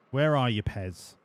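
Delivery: ordinary talk, nothing marked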